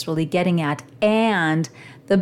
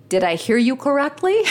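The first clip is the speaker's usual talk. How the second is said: rising check-in; 6 semitones higher than usual. Retold or imitated. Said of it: "let's stop there just a minute".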